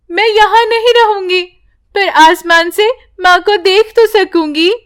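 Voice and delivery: put-on high, desperate girl's voice; a girl begging the tree to save her mother; pleading child. Priya offers herself. (ऊँची, गिड़गिड़ाती बच्ची)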